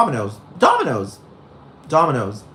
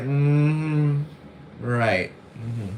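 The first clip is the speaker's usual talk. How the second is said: knowing Trisha; 5 dB quieter, much slower than usual. Retold or imitated. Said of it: "she's like".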